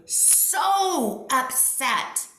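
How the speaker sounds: SO stretched out long and high; heavy, drawn-out stress on SO and upset; emphatic stress. Real, not acted. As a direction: drag out "so" for emphasis. Stressing how upset friend was.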